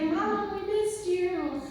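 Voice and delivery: imitating the children's sweet hello; kids greeting her. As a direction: sound like a little kid calling her Mom. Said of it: "be like".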